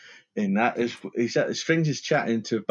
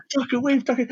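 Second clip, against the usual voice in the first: high mock coaching; 11 semitones higher than usual. Quoting the trainer's advice.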